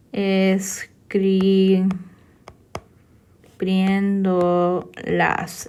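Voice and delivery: very slow, broken into syllables; drawn out. Shown as a demonstration. Teacher writing out escribiéndolas for students, drawn out. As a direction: stretch "escribiéndolas" out piece by piece, with long gaps. Spelling out the word while writing.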